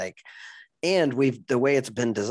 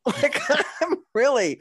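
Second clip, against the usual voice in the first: high laughing words; 3 dB louder than usual. Real, not acted. Laughing at own coming out story.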